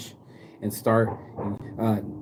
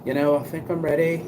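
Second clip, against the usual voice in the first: put-on higher-pitched English accent, resigned; English granny voice, ready to go; English grandma accent; 6 semitones higher than usual. Vlogger voicing a friend's English grandma in an English accent. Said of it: "he did it in an English accent".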